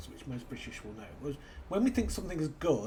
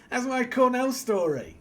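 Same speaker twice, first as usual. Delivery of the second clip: laughing; 11 semitones higher, 10 dB louder than usual. Real, not acted. Laughing at own story.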